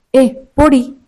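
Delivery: a touch of a sharp telling-off; scolding. Acted. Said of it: "Jimin உங்களை அதட்டுறாரு".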